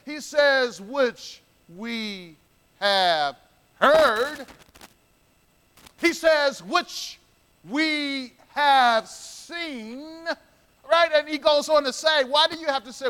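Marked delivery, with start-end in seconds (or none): 0.8-10.3 slow, weighty stretch on each word; drawn-out scripture quote. Acted. Preacher to congregation, slowing down the verse. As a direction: lean into every word of the verse.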